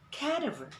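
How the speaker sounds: CADAVER with stress on the first syllable, KAD-uh-ver; common mispronunciation. Shown as a demonstration. Teacher showing viewers what most people say, common mispronunciation. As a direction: punch the first syllable of CADAVER, glide the rest. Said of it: "that is not the pronunciation".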